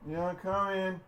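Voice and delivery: calling out. Real, not acted. Calling out to someone away.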